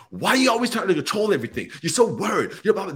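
high, fast mock tirade; hammed-up roommate going off on you. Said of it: "they just go off on you".

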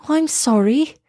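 high, apologetic exclamation; crying out. A narrator's reading. Girl apologises to the turtle, high dismayed cry. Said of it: "she cried".

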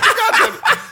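high laughing God damn; squeaky laughing exclamation; laughing curse. Retold or imitated. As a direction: cackle it out in disbelief. Caught in wrong house.

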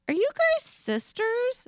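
put-on curious older lady. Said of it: "this lady was like".

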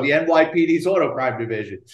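usual speaking voice

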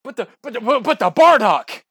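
loud, high, slowed protest; indignant protest. Real, not acted. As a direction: cry out in disbelief for his favorite. Protesting the guest's pick.

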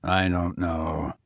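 low, slow, bitter tramp; a low bitter admission; bitterly. A narrator's reading. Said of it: "he said bitterly".

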